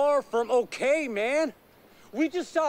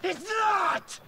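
higher pitched shout of protest; angry shout; 5 semitones higher than usual. Acted. Nick, fed up with his calm friends, shouts in protest. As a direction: yell it at all of them.

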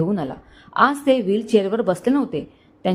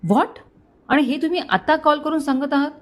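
a touch higher and sharper, mildly voiced; mildly raised, shocked commissioner; 6 semitones higher than usual. A narrator's reading. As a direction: push the pitch up in disbelief. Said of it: "थोड्या वरच्या पट्टीत बोलले".

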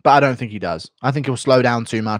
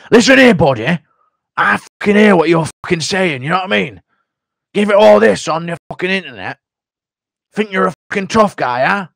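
higher, louder English tough-guy threat; Michael Bisping confronting; 6 semitones higher, 5 dB louder than usual. Retold or imitated. Bisping confronting an internet critic, loud English accent. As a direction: square up to him, English accent. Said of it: "Michael Bisping confronting you impression".

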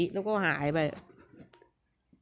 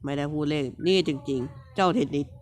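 normal talking voice

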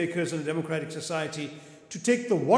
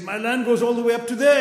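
higher, louder, proudly showing off his land; proud boast; 6 semitones higher, 5 dB louder than usual. Retold or imitated. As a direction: puff up with pride as the Indian farmer. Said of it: "he said very proudly".

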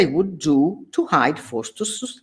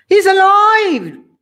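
high, loud, slow scream; terrified scream; 9 dB louder, slower than usual. Acted. Knight seeing the headless man rise, screaming. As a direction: scream it in shock. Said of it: "he screamed".

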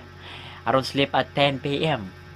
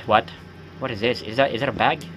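usual speaking voice

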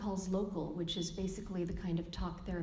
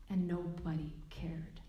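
whisper. Real, not acted. Deflating punchline of Zen story.

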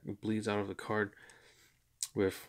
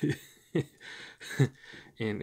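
laughing; 2 semitones higher than usual. Real, not acted. Laughs at his monotone voice.

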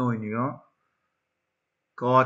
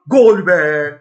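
loud, high goal yell; real joy, shouting; 4 semitones higher, 13 dB louder than usual. Real, not acted. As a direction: scream the goal out. Goal scored.

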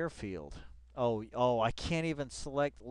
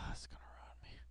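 quick whisper after a sigh; 17 dB quieter, faster than usual. Real, not acted. Streamer defeated by the browser, sighed whisper. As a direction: breathe it out defeated.

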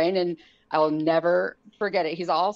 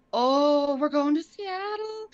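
high, surprised exclamation; 9 semitones higher, slower than usual. Retold or imitated. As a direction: squeak it out in disbelief.

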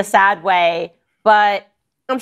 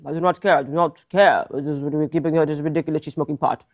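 put-on lightly done Russian accent, low and soft; bad Russian accent; 4 semitones lower, 4 dB quieter, faster than usual. Putin brushing off America's call, bad Russian accent. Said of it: "This is a very bad Russian accent".

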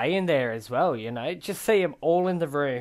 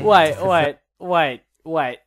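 shouted wait, wait; loud urgent stop; 9 dB louder, slower than usual. Real, not acted. Stopping the clip in excitement, loud. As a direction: cut in loudly.

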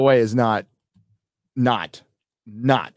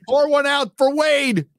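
mock toast; 14 semitones higher than usual. Mock toast for dead character.

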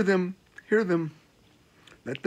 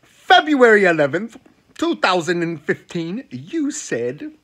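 a hint of an accusing girlfriend, louder at the start; 5 semitones higher, 6 dB louder, slower than usual. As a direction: read the date out like evidence. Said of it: "the girl go back to the bookmark and be like".

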